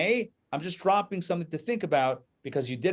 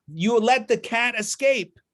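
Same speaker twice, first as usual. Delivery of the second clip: angry accusation; 7 semitones higher, 3 dB louder, slower than usual. Acted. Man blames assistants.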